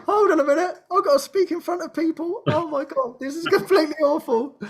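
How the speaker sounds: high, loud comic alarm; mock panic. Realizing she must speak before people, mock panic. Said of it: "I'm absolutely terrified".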